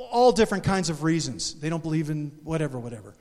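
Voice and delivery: normal talking voice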